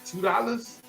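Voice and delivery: high-pitched, restrained disbelief; incredulous question. Retold or imitated. Boy questions the small payment.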